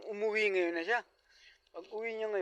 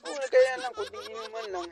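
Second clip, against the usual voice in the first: laughing excuse; 5 semitones higher, 8 dB louder than usual. Acted. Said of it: "sabi niya".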